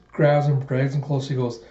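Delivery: ordinary delivery, nothing marked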